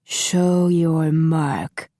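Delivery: slow, muffled, commanding villain; muffled demand. A narrator's reading. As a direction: demand it slowly behind a mask. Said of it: "Master Han demanded in his muffled voice".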